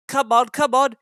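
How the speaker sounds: puffing engine. A narrator's reading. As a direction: puff it out like a working engine. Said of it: "he puffed".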